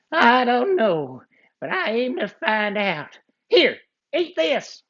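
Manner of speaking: high, slow cartoon bad guy; outlaw voice. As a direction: test the kid slyly.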